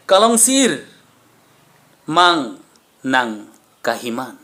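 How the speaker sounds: slow, declaimed verse, lines opened high and loud; ringing line starts that fall away; poem recitation. Acted. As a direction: recite in measured phrases with long pauses.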